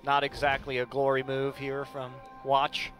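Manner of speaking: normal talking voice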